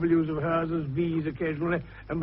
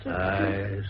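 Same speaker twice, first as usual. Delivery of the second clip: usual speaking voice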